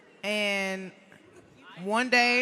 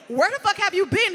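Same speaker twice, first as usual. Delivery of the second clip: angry; 8 semitones higher, 4 dB louder than usual. Retold or imitated. Confronting late EJ.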